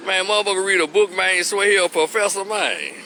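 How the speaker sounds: mock a brother hating in Memphis slang; Memphis hating talk. Memphis man hating on a bookish guy, drawled and mocking. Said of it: "we'll say something like".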